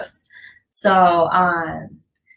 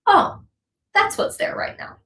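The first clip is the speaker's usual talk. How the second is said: higher, half-whispered gentle noticing; quoting an inner observer; 14 semitones higher than usual. Retold or imitated. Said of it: "taking a step back and saying".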